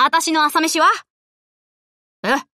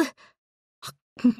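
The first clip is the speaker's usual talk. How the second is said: 1 relish; 6 dB quieter, much slower than usual. Acted. First bite of food.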